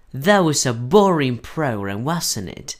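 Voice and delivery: slow, clear sentence with "wasn't it" dropping at the end; falling question tag. Shown as a demonstration. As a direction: say "wasn't it" as confirmation, voice going down. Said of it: "No estoy preguntando, solo estoy pidiendo confirmación".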